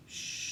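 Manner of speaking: unvoiced shh breathed out slowly; quiet shh demo for the class; long hushing exhale. Shown as a demonstration. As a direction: let the air out on a steady shh. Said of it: "you're gonna exhale on shh".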